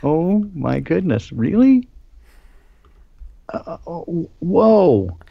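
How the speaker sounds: rising shocked exclamations; surprised. Real, not acted. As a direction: gasp it out, taken aback. Reacting to the article.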